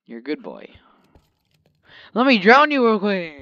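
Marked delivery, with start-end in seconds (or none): 2.1-3.3 mock threat. Joking threat to his dog.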